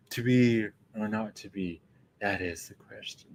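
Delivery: airy, ephemeral. Retold or imitated. The classic Hamlet musing the line, soft and airy. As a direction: float it away, dreamy and ephemeral. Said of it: "it's all ephemeral and ... very airy".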